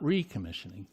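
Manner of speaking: "recommissioning" with the weight on its first syllable, RE; contrastive stress. Real, not acted. Speaker corrects any misreading of the pool plan, stressing RE in "recommissioning". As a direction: punch the "re" in "recommissioning" as the word being ruled out. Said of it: "I stress the word decommissioning".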